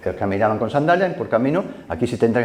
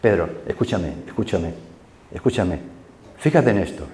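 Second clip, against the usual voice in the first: God's voice speaking; 6 semitones lower, 4 dB quieter than usual. Acted. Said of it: "va a decir".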